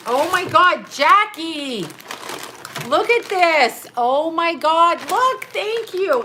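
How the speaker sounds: high, loud, drawn-out squeals of delight; so excited. Real, not acted. Showing off Jackie's popcorn gift, so excited. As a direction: gush loudly, stretching the words. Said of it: "Look, I'm so excited".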